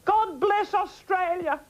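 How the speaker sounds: high slow benediction to the viewers; grand farewell blessing. Acted. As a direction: bless the nation with a grand flourish. Sign-off blessing, sung out high.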